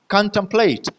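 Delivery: Contemplate with टेम left weak, कॉन stressed; unstressed syllable demo. Shown as a demonstration. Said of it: "तो ये unstress है". (Contemplate में कॉन पर ज़ोर, टेम पर नहीं)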